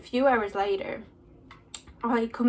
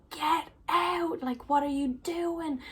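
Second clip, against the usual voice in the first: shouted at; 7 semitones higher than usual. Retold or imitated. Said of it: "I'm absolutely shouted at".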